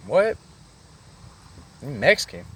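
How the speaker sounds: high, loud incredulous question; mock disbelief. Dude learns who bangs Crip, incredulous. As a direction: imitate someone taken aback, pitch leaping up. Retold reaction on learning he's Mexican.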